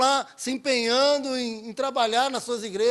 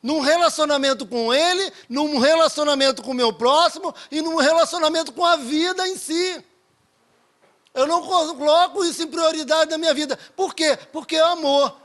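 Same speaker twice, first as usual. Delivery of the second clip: higher and louder, pressing each phrase home; preaching at full force; 6 semitones higher, 6 dB louder than usual. Acted. Preacher to the congregation about God's love, at full force. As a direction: drive the point home like a pulpit climax.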